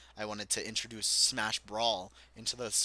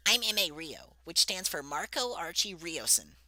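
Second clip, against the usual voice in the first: higher, put-on racer voice; Mario character voice; 7 semitones higher than usual. Racer telling Daisy his full name, in a higher put-on voice. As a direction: introduce yourself proudly.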